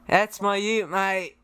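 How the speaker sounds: imitation Aussie accent; Australian accent. Shown as a demonstration. Guest copies the host's ute phrase, Australian accent. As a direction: echo it like an Australian mate. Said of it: "do the Australian thing".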